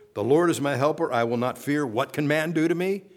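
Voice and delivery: preacher declaring with confidence; confident proclamation. Acted. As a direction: proclaim it boldly. Full-force declaration.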